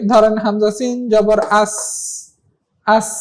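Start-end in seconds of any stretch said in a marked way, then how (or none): 1.6-3.1 আস ending in a sustained s, no vowel after it; no bounce. Shown as a demonstration. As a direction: let the s of আস hiss out without any push. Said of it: "এখানে কোনো ধাক্কা নাই".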